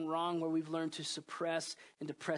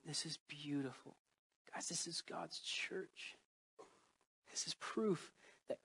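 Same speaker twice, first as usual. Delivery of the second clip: hushed whisper, barely voiced; awed whisper to the church; 9 dB quieter than usual. Real, not acted. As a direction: lean in and whisper it in awe. Whispered awe in sermon.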